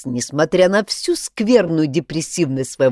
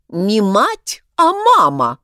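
louder and higher, snapping the correction; angry correction; 4 semitones higher, 6 dB louder, slower than usual. A narrator's reading. Crab correcting the drunk upstairs, angry. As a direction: insist hotly on мама, not мать. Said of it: "рассердился Краб Крабович".

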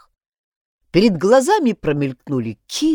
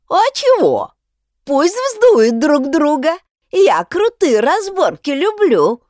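high, loud and slow, relishing a fight; eager objection; 10 semitones higher, 6 dB louder, slower than usual. A narrator's reading. Shovel objecting, keen to watch a brawl, excited. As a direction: egg the brawlers on gleefully. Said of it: "возразила Лопата".

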